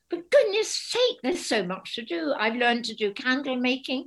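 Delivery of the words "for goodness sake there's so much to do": exasperated retort. Retold or imitated. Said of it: "And I go".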